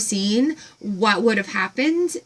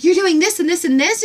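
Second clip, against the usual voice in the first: higher and louder rapid excited questions; excited gush; 7 semitones higher, 4 dB louder than usual. Retold or imitated. Retelling meeting the farm woman, gushing excitedly. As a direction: gush like you just met a kindred spirit. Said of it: "I'm like".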